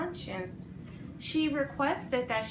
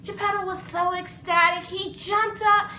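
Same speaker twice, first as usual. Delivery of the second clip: louder, higher, building with joy; excited narration; 7 semitones higher, 5 dB louder than usual. A narrator's reading. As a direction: tell it like great news bursting out.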